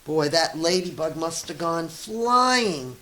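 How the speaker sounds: plain reading voice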